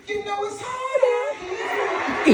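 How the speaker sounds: put-on very high, drawn-out girl's voice; Jaden. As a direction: stretch it out slowly, high-pitched. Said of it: "slid right in front of them steps and said".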